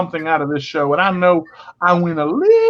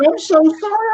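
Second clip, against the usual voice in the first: crying with laughter; 14 semitones higher, 3 dB louder, faster than usual. Retold or imitated. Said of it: "and started crying".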